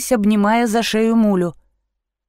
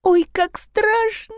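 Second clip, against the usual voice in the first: very high, slow, scared voice; frightened near-whisper; 11 semitones higher, slower than usual. A narrator's reading. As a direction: draw it out like a frightened child. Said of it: "зашептала Лиза". (испуганно, высоко и протяжно)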